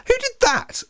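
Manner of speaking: very high, startled demand; shocked outcry. A narrator's reading. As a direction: cry out in shock, pitch shooting up. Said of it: "crying out more in shock than pain".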